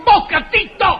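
angry. Acted. Doctor snaps at pest.

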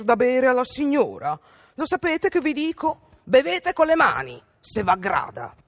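put-on high, loud sneering girl; arrogant rudeness. A narrator's reading. Rude girl mocking the fairy at the fountain, haughty sneer. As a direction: sneer at the lady with haughty scorn. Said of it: "quella sgarbataccia piena di boria".

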